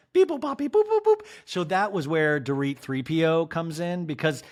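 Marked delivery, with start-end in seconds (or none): 0.1-1.2 high robotic beep-boop. Retold or imitated. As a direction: chirp like a droid. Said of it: "It was like".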